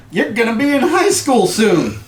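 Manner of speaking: high, loud hearty dad voice; proud dad. Acted. Dad ruffling his son's hair, hearty and proud. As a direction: boom it out like a proud father.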